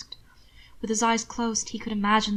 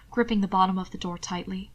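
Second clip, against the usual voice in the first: usual speaking voice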